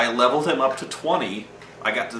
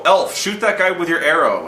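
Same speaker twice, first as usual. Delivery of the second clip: mock bossy shout; 6 semitones higher, 6 dB louder than usual. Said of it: "you hear the guy go".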